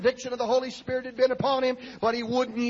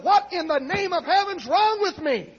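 raised, high-pitched cry of a man at breaking point; exasperated outcry; 9 semitones higher, 7 dB louder than usual. Retold or imitated. Miserable man pleading to his wife for answers, exasperated outcry. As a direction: cry out as if fed up with yourself.

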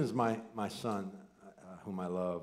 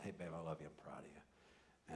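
tender goodnight; 6 semitones lower, 20 dB quieter than usual. Retold or imitated. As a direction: ease it out gently like tucking her in. Father's late-night goodnight to daughter.

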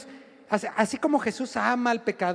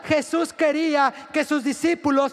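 higher, louder preacher's cadence; full-force preaching; 4 semitones higher, 3 dB louder than usual. Acted. Preacher exhorting the congregation, louder and higher. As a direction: drive each line home to the crowd.

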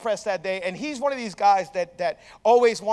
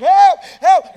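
high, loud mock yell; imitated cry for help; 10 semitones higher, 12 dB louder than usual. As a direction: yelp like someone pinned under weights. Imitating trapped friend crying for help.

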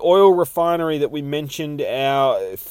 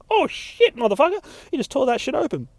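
much higher, fast astonished yelp; 13 semitones higher, faster than usual. Acted. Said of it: "they're like".

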